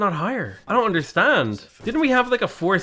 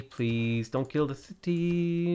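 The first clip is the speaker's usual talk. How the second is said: quiet plea; 8 dB quieter, much slower than usual. Real, not acted. Pleading with barbarians.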